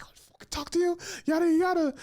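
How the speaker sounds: high, fast, squeaky mock of a jealous girlfriend. Ex-girlfriend scolding her boyfriend for talking to him, high mocking squeal. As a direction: mimic her shrieking jealousy in a cartoon falsetto. Ex yelling again.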